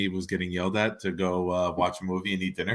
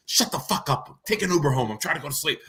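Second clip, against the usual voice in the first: a bit higher, mock annoyed scolding; imitating his ex; 5 semitones higher than usual. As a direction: lightly play the irritated ex brushing him off. Voicing ex telling him off.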